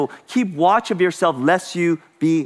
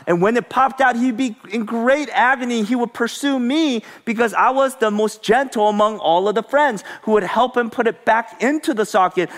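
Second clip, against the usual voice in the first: usual speaking voice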